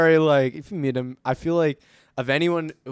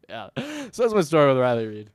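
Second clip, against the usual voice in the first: ordinary talk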